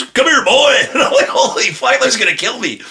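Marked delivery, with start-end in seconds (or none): 0.0-0.7 loud, high cowboy holler; hammed-up Texan shouting an order; big Texan. Big Texan summons him to the stall, shouted impression. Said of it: "this Texan fucking goes". 1.3-2.8 high, comic alarm; retold fright. Facing the huge Texan in the bathroom, mock fright. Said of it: "Oh, he scared me".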